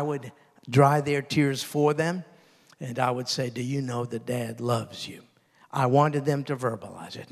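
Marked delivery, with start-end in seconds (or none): 3.5-5.2 whispered to a tearful son; gentle fatherly whisper; hushed reassurance. Retold or imitated. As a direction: whisper it tenderly, close in. Father reassuring son after discipline.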